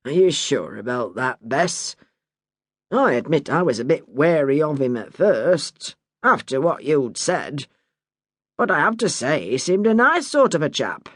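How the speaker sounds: a hint of a wheedling old man; Bert, easygoing husband. Bert defends neighbour.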